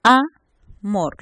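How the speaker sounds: Amor split as A, pause, MOR; loud A set apart in Amor; syllable by syllable. Shown as a demonstration. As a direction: break Amor into two separate syllables. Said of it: "La primera sílaba".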